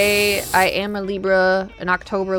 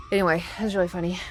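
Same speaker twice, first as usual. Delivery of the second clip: ordinary talk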